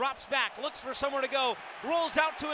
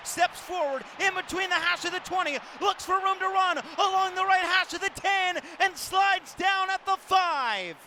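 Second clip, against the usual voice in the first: excited scramble call; 10 semitones higher, 6 dB louder than usual. Real, not acted. Sportscaster calls the quarterback's run to the five, excited shout. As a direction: let the run carry the voice up.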